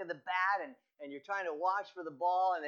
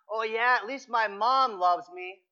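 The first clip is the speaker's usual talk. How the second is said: louder, higher indignant comeback; indignant yell; 5 semitones higher, 7 dB louder than usual. Retold or imitated. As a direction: shout it back, hurt but defiant. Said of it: "he yells at it ... indignant".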